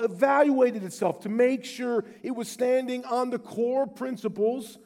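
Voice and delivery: full-voiced pulpit intensity; rising preaching. Real, not acted. Preaching to the congregation.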